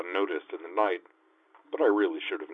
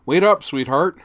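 loud, higher flustered dad call; harried dad; 9 semitones higher, 10 dB louder than usual. A narrator's reading. Father hurrying after his daughter, harried call. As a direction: chase after your kid. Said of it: "in a harried dad voice".

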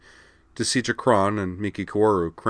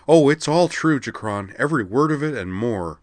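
higher, louder angry agreement; 7 semitones higher, 6 dB louder than usual. A narrator's reading. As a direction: bitterly confirm every word. Mia still raging to Jakron.

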